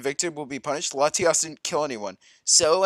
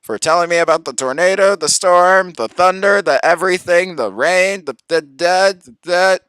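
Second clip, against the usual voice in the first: sarcastic rant; 3 semitones higher, 10 dB louder than usual. Real, not acted. Sarcastic loud listing.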